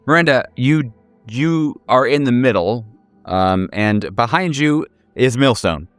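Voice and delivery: ordinary delivery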